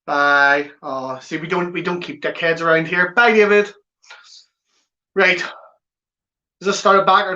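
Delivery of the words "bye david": louder, higher sing-song dismissal; gleeful taunting goodbye; mocking farewell. Real, not acted. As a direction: wave off the troll with relish. Dismissing a troll.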